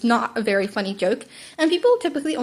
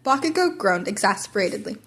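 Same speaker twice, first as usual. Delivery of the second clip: plain reading voice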